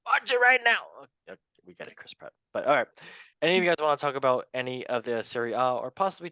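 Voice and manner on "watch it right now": high, loud yelled plug; hammed-up shouted command; sudden mock shout. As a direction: burst out with a comic order. Sudden shouted plug in guest role.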